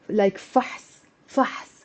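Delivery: فحص as strict fahs, no vowel between ح and ص; فحص as a single closed syllable; one syllable. Shown as a demonstration. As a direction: run ح straight into ص in فحص. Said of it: "one syllable words in Arabic".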